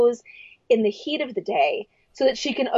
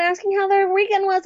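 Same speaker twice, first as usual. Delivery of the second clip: high-pitched mock-perky chatter; bubbly, exaggerated friendliness; super perky; 8 semitones higher than usual. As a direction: put on an extra-perky teacher voice. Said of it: "super perky".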